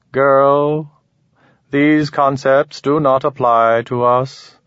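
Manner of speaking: high, slow, detached alien; cool otherworldly being, measured and precise. Acted. Alien explains.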